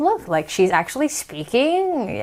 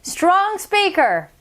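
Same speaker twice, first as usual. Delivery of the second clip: raised, slow, projected; strong speaker voice; 5 semitones higher, slower than usual. Shown as a demonstration. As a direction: project it to a whole group. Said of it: "This is your strong speaker voice".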